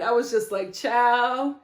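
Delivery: ordinary delivery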